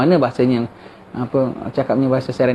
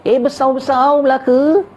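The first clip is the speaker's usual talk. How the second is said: slow, much higher imitation of a Melaka local's accent; 10 semitones higher, slower than usual. Preacher showing how a Melaka person sounds, in a slow, high Melaka accent. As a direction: drawl it out like someone from Melaka. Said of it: "Negeri, umpamanya".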